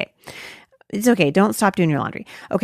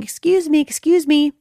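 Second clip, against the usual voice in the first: higher-pitched imitation of a man hailing someone; man calling out; 9 semitones higher than usual. A man hailing her outside the grocery store, imitated calling out. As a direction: mimic a passerby trying to get attention. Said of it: "I could hear a man saying".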